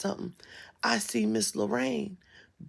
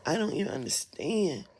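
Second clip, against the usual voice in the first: ordinary delivery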